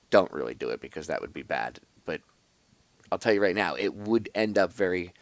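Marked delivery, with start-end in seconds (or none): none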